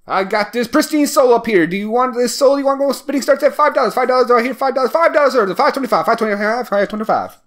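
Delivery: high, fast auctioneer patter that winds down. Retold or imitated. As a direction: rattle off bids like an auctioneer selling a soul. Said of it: "it almost sounds like an auctioneer".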